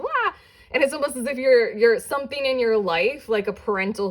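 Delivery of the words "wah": a much higher, playful burst of a yell; wild child cry. Pretend. Tarot reader voicing the wild inner child, playful high whoop. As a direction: let out a carefree kid's whoop. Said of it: "kind of like".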